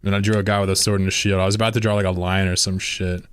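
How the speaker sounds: ordinary talk, nothing marked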